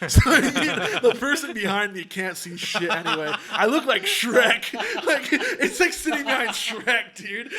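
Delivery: loud, high, laughing exclamation. Real, not acted. Joking about the person seated behind him, loud real laughter. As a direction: crack up while exclaiming. Cracking up at own size.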